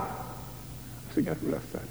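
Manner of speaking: whispered, slow contrast. Shown as a demonstration. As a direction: drop to a whisper on „strigat”. (șoptit, rar)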